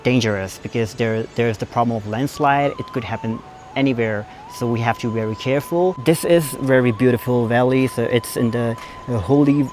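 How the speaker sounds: careful, drawn-out American accent. Shown as a demonstration. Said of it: "थोड़ा English American में बोल के देखते हैं". (धीमा, गहरा अमेरिकन लहजा)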